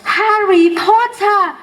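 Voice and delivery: high, slow squeak of a house elf; slow high elf voice calling out; high-pitched creature voice. A narrator's reading. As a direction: stretch his name out high and eager. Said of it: "said the creature in a high-pitched voice".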